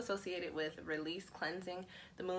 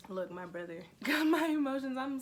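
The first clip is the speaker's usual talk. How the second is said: higher and louder, laughing through the words; laughing, moved; 8 semitones higher, 5 dB louder than usual. Real, not acted. Telling viewers her brother moved her, laughing. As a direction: let the laugh break in, caught off guard.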